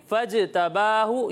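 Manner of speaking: फजतबाहु slowly, the ज bouncing back; echoing qalqala. Shown as a demonstration. Teacher showing children qalqala, a bouncing ज in फजतबाहु. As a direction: let the ज in फजतबाहु echo, drawn out. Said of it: "जीम की आवाज़ लोटती हुई महसूस".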